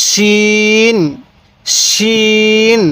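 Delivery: শিন with a thick sh and long-held vowel; thick sheen. Shown as a demonstration. Teacher contrasts two confused letters, thick sh in শিন. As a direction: make the sh of শিন full, then stretch it evenly. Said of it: "একটু মোটা করে উচ্চারণ".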